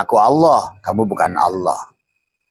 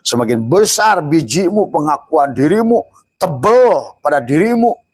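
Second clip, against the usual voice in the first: higher, louder rising warning; preaching at full force; 8 semitones higher, 5 dB louder than usual. Acted. Preacher warning the congregation, loud and high. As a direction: hammer the point home to the congregation.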